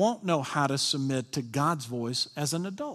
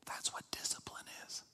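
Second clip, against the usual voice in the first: whisper; 18 dB quieter than usual. Real, not acted. Sermon point whispered.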